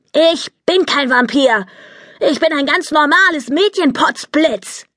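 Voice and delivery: hoch und trotzig empört; zornig. A narrator's reading. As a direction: stampf mit der Stimme auf, kindlich wütend. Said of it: "Zornig stampfte sie". (Polly protestiert wütend gegen die Eltern, hoch und trotzig)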